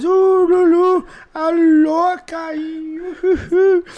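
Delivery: high, loud party whoops; crowd whooping. Retold or imitated. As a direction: hoot like a cheering crowd.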